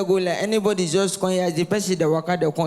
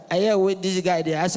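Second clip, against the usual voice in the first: normal talking voice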